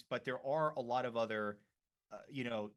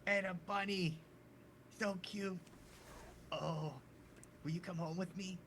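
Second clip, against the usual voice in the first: put-on high, soft-ish cooing child; adoring little kid; 7 semitones higher, 4 dB quieter than usual. Little boy begging the bunny to come home, high and adoring. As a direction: coo over the cute bunny.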